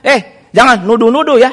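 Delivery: mock-indignant warning with tone rising. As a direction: imitate a wife getting heated. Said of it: "Terus nadanya naik".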